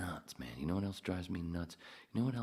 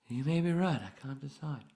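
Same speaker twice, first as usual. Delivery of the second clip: high-pitched put-on character voice; Arthur impression; 10 semitones higher, 6 dB louder than usual. Arthur replying about decision making, high acted voice. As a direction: answer high, as movie Arthur.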